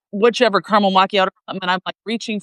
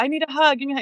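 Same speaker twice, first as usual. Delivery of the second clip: higher-pitched mock plea for affection; retold pleading; 6 semitones higher, much faster than usual. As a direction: re-enact begging a partner, voice lifted.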